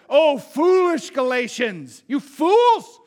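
loud, exasperated scolding; scolding Paul. Retold or imitated. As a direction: call them fools with full force. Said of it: "the tone shifts".

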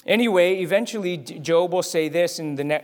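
ordinary talk

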